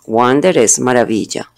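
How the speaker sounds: normal talking voice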